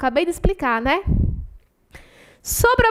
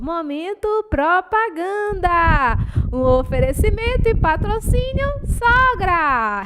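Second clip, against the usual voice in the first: anúncio de propaganda; 5 semitones higher than usual. Pretend. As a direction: chant it like a silly sponsor spot. (propaganda cantarolada, aguda e arrastada)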